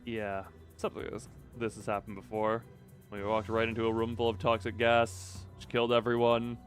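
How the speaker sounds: low, measured story reading; subdued narration. Acted. Clock-headed manager thinking over the gas, subdued narration. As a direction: read it as a resigned inner monologue. Reads the narrator's inner monologue.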